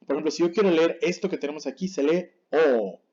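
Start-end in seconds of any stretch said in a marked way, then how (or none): 2.5-3.0 O held for two beats; one long, sustained O. Shown as a demonstration. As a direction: stretch the O out slowly. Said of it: "Es un sonido largo".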